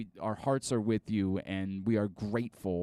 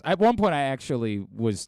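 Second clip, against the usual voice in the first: usual speaking voice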